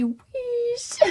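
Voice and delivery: high, soft drawn-out squeal; pet call. Acted. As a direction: squeak the pet's name softly and long. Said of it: "I say".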